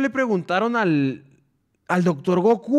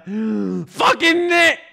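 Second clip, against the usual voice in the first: put-on much higher, much louder kid's tantrum yell; bratty tantrum scream; 6 semitones higher, 7 dB louder, much slower than usual. Braulio locked in his room, tantrum scream. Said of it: "Y el Braulio".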